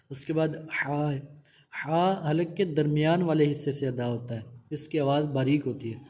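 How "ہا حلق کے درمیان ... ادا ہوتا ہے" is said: ordinary delivery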